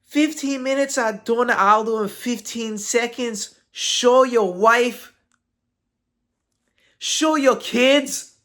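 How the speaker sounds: high, slow, mocking tweet read; reading Conor's tweet. Reading a fighter's taunting tweet aloud, high mocking voice. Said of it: "I'm reading my best".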